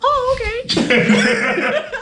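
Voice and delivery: high, drawn-out, laughing reply; retold realization. Athlete retelling meeting a basketball star, amused realization. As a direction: stretch it up high through a laugh.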